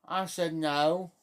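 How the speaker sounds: normal talking voice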